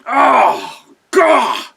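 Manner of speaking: high, loud hammed-up shriek of disgust; mock grossed-out shriek. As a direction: shriek like he's being drenched in filth. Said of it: "He goes".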